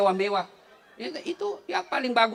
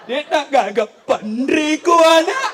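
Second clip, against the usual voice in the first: higher, louder hammed-up wailing in local dialect; mock wailing widow; 10 semitones higher, 8 dB louder, slower than usual. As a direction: imitate a grieving woman wailing, laughing through it. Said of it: "Ada orang meninggal suaminya". (meniru ratapan janda, dilebih-lebihkan sambil tertawa)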